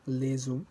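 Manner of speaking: Les hommes with the S as z, lez-hommes; liaison with les. Shown as a demonstration. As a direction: link the S of les onto hommes. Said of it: "Pronouncing the S".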